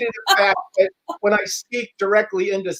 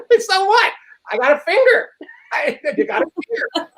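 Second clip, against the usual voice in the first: laughing through the words; can't stop laughing at the slip; 11 semitones higher than usual. Real, not acted. Laughing at his own finger-fear slip, real laughter.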